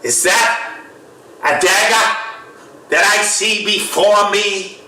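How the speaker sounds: put-on high, slow Shakespearean declaiming with a Boston accent; horrible Boston accent. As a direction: ham up Macbeth in broad Bostonian. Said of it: "you got that horrible Boston accent".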